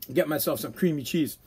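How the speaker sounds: ordinary talk, nothing marked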